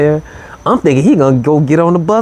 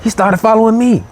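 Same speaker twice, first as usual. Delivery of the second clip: surprised; 9 semitones higher than usual. Real, not acted. Surprise twist in his story.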